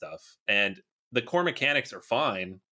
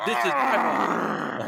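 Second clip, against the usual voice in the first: mock dramatic gamer; 4 dB louder, much slower than usual. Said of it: "They're like".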